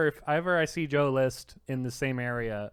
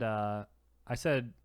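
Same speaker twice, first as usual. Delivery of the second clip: ordinary talk, nothing marked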